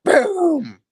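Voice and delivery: high, loud drawn-out boom; explosive boom. Acted. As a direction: stretch out a mock explosion. Imitates explosion in villain's taunt.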